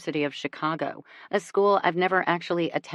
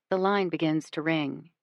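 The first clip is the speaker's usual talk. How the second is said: plain reading voice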